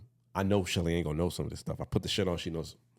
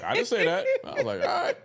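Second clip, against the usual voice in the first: laughing; 4 dB louder than usual. Retold or imitated. Retold exchange, laughing through it.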